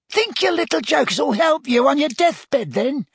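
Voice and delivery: high, loud jeer. A narrator's reading. Death Eater woman mocking Dumbledore with a high jeer. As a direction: sneer it up high like a cackling witch. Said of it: "she jeered".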